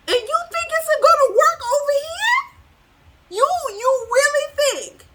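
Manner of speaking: high, indignant disbelief; incredulous. Real, not acted. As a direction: sneer at their nerve from way up high. Reader scolds the person who took it.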